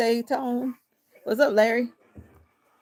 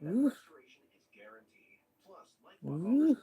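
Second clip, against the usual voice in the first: short pained grunt; real strained oof from the exercise; 3 semitones higher than usual. Real, not acted. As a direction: grunt like a sore shoulder on a lift. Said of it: "it's, it's like".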